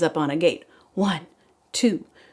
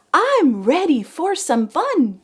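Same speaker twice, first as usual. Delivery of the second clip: high, eager and bright; eager pumpkin; 8 semitones higher, 4 dB louder, slower than usual. Acted. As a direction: bounce with eagerness. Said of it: "The fourth one said".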